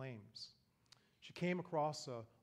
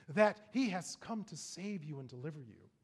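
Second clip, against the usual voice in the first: usual speaking voice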